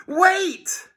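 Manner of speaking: high urgent cry; panicked high call. A narrator's reading. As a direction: shout it to stop him. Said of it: "cried George".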